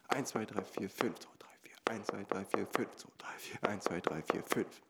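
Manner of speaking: leise, halb geflüstert, jede Eins betont; Eins betont zählen. Shown as a demonstration. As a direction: die Eins als schwere Betonung zählen. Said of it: "die nächste Eins vom nächsten Takt". (jede Eins hervorheben)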